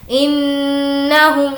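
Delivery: very slow انہم with the نّ held nasally; ghunna on the nun. Shown as a demonstration. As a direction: hum the doubled نون in انہم. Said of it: "نون پر تشدید ہے، غنہ ہوگا". (غنہ کے ساتھ انہم)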